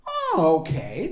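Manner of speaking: exaggerated reaction, jumping up in pitch; mock surprise. Guesser reacting to the young man's answer, playfully surprised.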